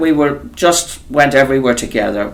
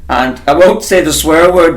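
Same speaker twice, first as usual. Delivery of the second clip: usual speaking voice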